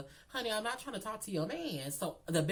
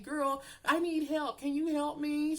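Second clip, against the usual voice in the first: high-pitched, drawn-out mock plea; pleading for help; 9 semitones higher than usual. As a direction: beg like a helpless damsel. Acting out her plea for help.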